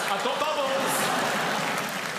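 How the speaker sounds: slow, high thrilled call; drawn-out excited cry. Real, not acted. Host spotting the reply bubbles, slow excited cry. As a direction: savour the moment.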